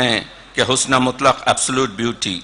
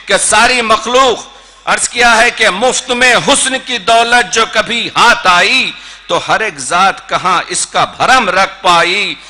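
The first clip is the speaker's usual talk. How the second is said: Urdu poetry recitation; 8 semitones higher, 7 dB louder than usual. Acted. Poet closes the poem on beauty for the audience, declaimed.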